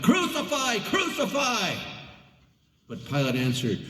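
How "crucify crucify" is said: high, shouted crucify; crowd shouting. A narrator's reading. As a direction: yell it as the angry priests. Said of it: "they shouted".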